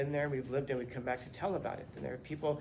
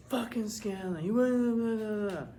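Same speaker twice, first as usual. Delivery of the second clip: a higher, louder mock of a sulky student cursing the teacher; resentful student; 7 semitones higher, 5 dB louder, slower than usual. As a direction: grumble like a student denied grace.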